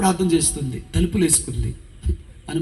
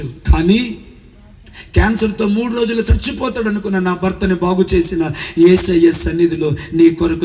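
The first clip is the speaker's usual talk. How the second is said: higher and louder, pleading and certain; fervent preaching; 3 semitones higher, 4 dB louder than usual. Acted. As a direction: preach it up into a promise. Preaching woman's words to mother.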